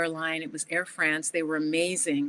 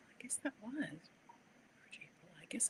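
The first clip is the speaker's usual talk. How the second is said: whispered aside; 5 semitones higher, 19 dB quieter than usual. Real, not acted. Muttering correction to herself.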